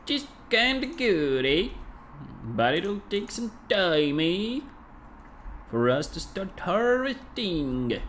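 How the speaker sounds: high, slow mock English accent; hammed-up English accent, slow and high; silly English accent. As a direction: put on a goofy English-speaking accent. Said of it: "rather silly attempt to perform some English-speaking kind of an accent".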